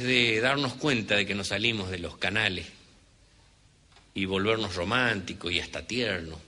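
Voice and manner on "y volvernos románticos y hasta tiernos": usual speaking voice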